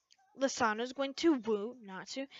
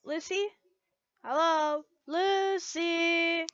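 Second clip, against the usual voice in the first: calling out; 4 semitones higher, 5 dB louder, much slower than usual. Acted. Levy calling out to Lucy.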